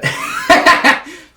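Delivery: high-pitched burst of laughter; loud laugh. Real, not acted. Cracking up while telling an awkward story, loud laugh. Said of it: "they laugh very loudly".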